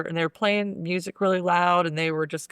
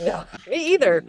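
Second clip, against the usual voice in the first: higher, louder reply breaking into laughter; amused laughing; 5 semitones higher, 3 dB louder than usual. Real, not acted. Co-host joking about never doing that, laughing. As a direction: laugh as you agree. Both hosts cracking up at joke.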